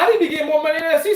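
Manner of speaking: fast, high, laughing through the boast; rattled off high and fast through laughter. Retold or imitated. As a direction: crack up while repeating it. Repeats line laughing, fast.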